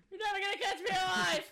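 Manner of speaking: very high, loud cry; hammed-up outlaw defiance; defiant yell. Captain fleeing ocean police, defiant mock yell.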